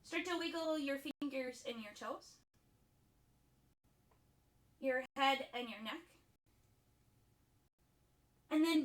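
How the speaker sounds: softened, measured cueing with long silences; guided yoga instruction. Acted. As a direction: guide them through breath and pose, leaving room between phrases. Leads breathing and yin yoga poses.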